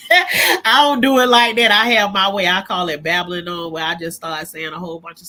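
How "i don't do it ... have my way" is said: laughing as she admits the joke, a bit louder; real laughter through the words. Real, not acted. Laughing at her own joke.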